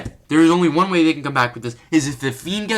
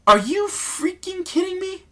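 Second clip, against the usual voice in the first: disbelief. Real, not acted. Disbelief at the stretcher.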